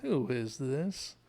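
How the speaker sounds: drawn-out, slow mock query; Jerry. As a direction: stretch each word, pretending not to know the caller. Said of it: "that's kind of the tone".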